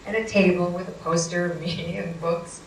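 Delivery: normal talking voice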